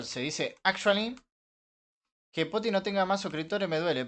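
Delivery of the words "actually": "actually" in a joking English accent; mock English accent. Shown as a demonstration. Streamer correcting chat, mock English accent.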